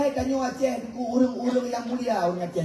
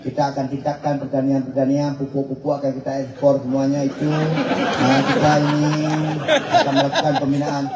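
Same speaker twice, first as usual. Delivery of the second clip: a mock Aceh-accented Indonesian address; 5 semitones lower than usual. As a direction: mimic a governor's Aceh-accented speech. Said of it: "Dia tetap dengan logat Acehnya".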